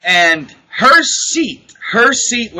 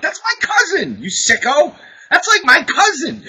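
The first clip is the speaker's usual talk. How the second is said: indignant retort; 9 semitones higher than usual. Retold or imitated. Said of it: "I'm like".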